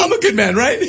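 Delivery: high, laughing half-whine. Real, not acted. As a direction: plead while cracking up.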